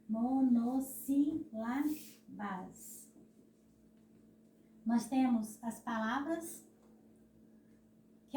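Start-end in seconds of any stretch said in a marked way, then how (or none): 0.1-3.0 MONOSSÍLABAS very slowly, mo-nos-sí-la-bas; sílaba por sílaba. Shown as a demonstration. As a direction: break monossílabas into separate syllables. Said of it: "Por que que a tia tá fazendo essa, essa força". (monossílabas, sílaba por sílaba, bem devagar)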